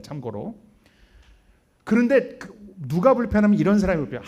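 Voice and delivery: ordinary talk, nothing marked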